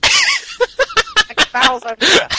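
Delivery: high, slow laughing voice, mocking the word barrels; laughing through it. Real, not acted. Mocking the barrels submission through laughter. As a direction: crack up while saying barrels. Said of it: "How do people find this funny?".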